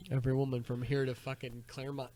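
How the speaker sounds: normal talking voice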